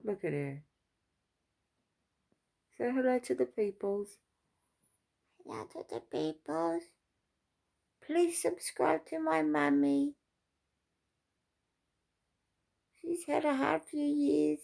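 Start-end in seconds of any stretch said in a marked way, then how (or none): 2.8-4.2 higher-pitched coaxing to a pet; coaxing pet talk. Real, not acted. Owner prompting her pet to greet the viewers, coaxing. 5.4-6.8 very high little pet voice; pet's voice. Pretend. Pet greeting the viewers when prompted, high put-on voice. 8.0-14.6 pet's voice. Pretend. Pet asking viewers to subscribe to mummy, high put-on voice.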